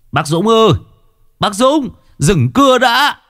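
high-pitched frantic call; stretched, high warning cry; urgent calling up. A narrator's reading. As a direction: holler up the tree to stop. Calling up to man sawing.

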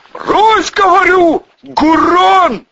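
high, loud bellowed command; yelling full-throated. A narrator's reading. Volyna orders gunman to drop it, yelling. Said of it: "завопил Волына во всё горло".

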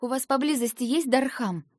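выше и громче, с тревогой; спросить в испуге; испуганный вопрос. A narrator's reading. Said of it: "испуганно спросила я". (испуганно «Дархам?»)

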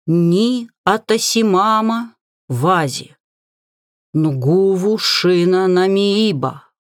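chanting a spell, slow and deliberate; slow incantation. Acted. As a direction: intone each foreign word slowly. Reading a spell slowly.

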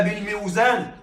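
بالميزان said as "bil-miw-zān"; بالميزان with the unsimplified miw syllable; unchanged root form. Shown as a demonstration. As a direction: keep a w after the i in بالميزان. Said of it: "هذا غير فصيح".

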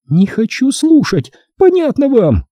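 put-on high, slow, childlike shriek; thin childish wail. Corola wails at him in a childish voice. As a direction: wail like a petulant little girl. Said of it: "завопила она тонким детским голоском".

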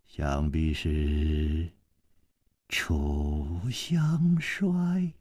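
low and slow, every syllable set apart; word by word. A narrator's reading. Old man naming his guest to his face, word by word. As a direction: spell it out one word at a time, deep and slow. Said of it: "一字字地说".

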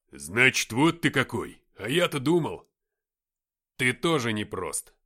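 loud, testy comeback; snappish retort. A narrator's reading. Captain answering back to Nikita, snapping. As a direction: snap back at a comrade. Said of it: "огрызнулся я".

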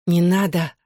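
mild, understated plea; pleading. A narrator's reading. Girl begs as Johnny tenses beside her, mildly pleading. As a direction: beg with a touch of urgency. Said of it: "взмолилась я".

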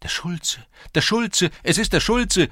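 panicked lads crying out; alarmed shouting. A narrator's reading. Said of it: "schrien die Burschen".

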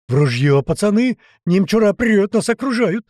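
high, loud, frantic call to arms; wild alarm yell. A narrator's reading. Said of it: "дикий вопль вскочившего мамули". (дикий вопль тревоги)

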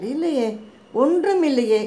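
high-pitched, flustered denial. A narrator's reading. Tearful woman denies anything is wrong, flustered. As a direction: deny it, thrown off balance. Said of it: "தடுமாறினாள் Padmavathi".

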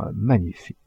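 usual speaking voice

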